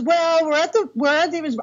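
airy high bride voice; high breezy bride. Retold or imitated. The bride texting from David's, high and breezy. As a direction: answer brightly like the bride. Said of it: "She goes".

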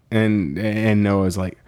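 ordinary talk, nothing marked

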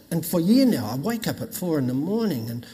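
normal talking voice

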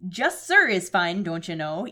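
slow, drawn-out Minnesotan; Minnesota accent. Acted. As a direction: stretch it like a Fargo local. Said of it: "This is like an episode of Fargo".

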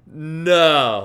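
loud, high, stunned no; hammed-up shock; retold disbelief. Shocked reply to aunt's revelation.

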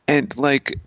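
ordinary delivery

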